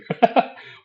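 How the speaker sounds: sudden high laugh; burst of laughter. Real, not acted. Laughing at annoying fans remark.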